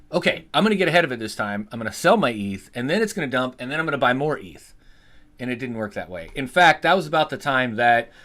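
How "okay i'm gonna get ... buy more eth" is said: fast scheming self-quote. Retold or imitated. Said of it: "And I'm like".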